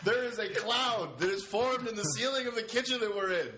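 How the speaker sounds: high-pitched grand showman announcing a marvel; mock proclamation. Mock time-travel announcement to listeners.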